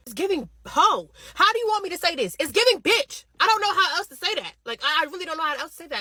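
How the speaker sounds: loud, high, exasperated rant; heated rant. Real, not acted. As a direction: raise your voice and vent. Ranting at boyfriend, raised voice.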